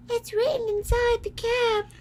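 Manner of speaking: very high squeaky mouse. A narrator's reading. Mouse queen explaining the cap to Dorothy, very high. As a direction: squeak the answer kindly. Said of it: "said the mouse".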